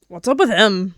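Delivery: ordinary delivery, nothing marked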